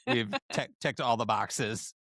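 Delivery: usual speaking voice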